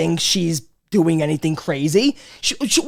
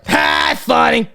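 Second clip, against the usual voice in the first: abrupt mock laugh, then a verdict; imitating father; much slower than usual. Father responding to his son's joke, imitated reaction. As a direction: play a dad reacting to a joke. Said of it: "he'll just be like".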